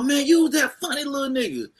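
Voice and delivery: high, lightly voiced headliner remembering; mock sudden recognition. A comic imitating the headliner recognizing him. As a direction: play the star's sudden recall.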